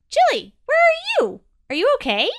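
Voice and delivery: high, loud elf voice; a worried little elf calling out. A narrator's reading. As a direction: elf calling out to his friend below. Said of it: "called E".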